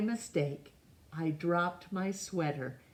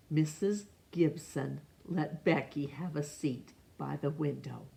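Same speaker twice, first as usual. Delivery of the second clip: usual speaking voice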